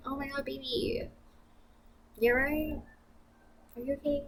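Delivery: imitating a doting boyfriend. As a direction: fuss over her like a sweet boyfriend. Boyfriend voiced fussing over her.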